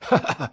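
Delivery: short loud burst of laughter; a laugh breaking out of a smile; client's laugh. Acted. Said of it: "broke into a laugh".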